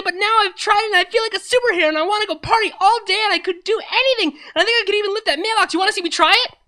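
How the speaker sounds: put-on very high, loud, rushing hyper party girl; shrill Pinky voice. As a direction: bounce off the walls with sugar-high glee. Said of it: "the same shrill voice from before".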